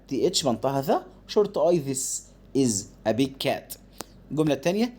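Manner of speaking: normal talking voice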